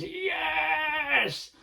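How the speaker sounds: a light imitation of a tennis fist-pump yell; Andy Murray yes. Comic impersonating a tennis star's celebration, lightly voiced yes. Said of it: "He goes".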